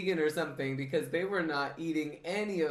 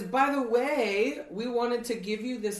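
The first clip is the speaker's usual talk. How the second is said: a sly lady hiding her plan; sweetly fake courtier; 6 semitones higher, 5 dB louder than usual. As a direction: lay the false sweetness on thick. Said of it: "Well, she says".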